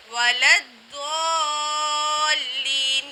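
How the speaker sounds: وَلَا الضَّالِّينَ chanted slowly with a thick, rounded "Doo"; heavy Dad. Shown as a demonstration. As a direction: hold the heavy ض very long in الضَّالِّينَ. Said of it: "یہاں ہم ضاد ادا کر رہے ہیں". (موٹے ضاد سے کھینچ کر تلاوت)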